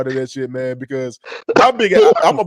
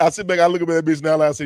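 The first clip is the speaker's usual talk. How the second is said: ordinary talk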